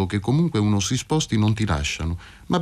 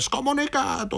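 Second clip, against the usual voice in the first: crowd's cry. A narrator's reading. Indignant congregation denouncing the man, crying out high. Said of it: "gli gridarono".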